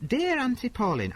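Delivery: high, bright reading of the opener. Acted. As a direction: pitch it way up. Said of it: "I won't do the accent".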